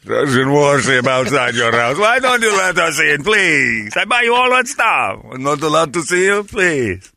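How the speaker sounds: high pleading mock warship voice, slow start; mock Russian warship. Host mock-begging as the warship, pleading voice. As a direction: whine to be let in like a needy warship.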